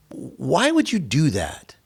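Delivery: slow, baffled retold question; baffled disbelief. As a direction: draw it out, struggling to understand.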